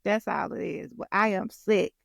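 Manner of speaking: ordinary talk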